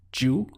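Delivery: "ju" as one isolated syllable with a j sound; syllable shown alone. Shown as a demonstration. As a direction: sound out "ju" as the first piece of "lluvia". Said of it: "We have".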